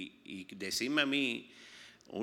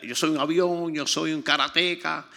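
lightly imitated boastful grandchild, higher and loud; nieto presumiendo; 6 semitones higher, 9 dB louder, slower than usual. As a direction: boast like an excited little kid. Imitates his excited little grandson boasting. (imitando al nieto que presume)

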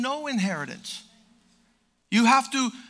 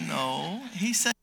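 normal talking voice

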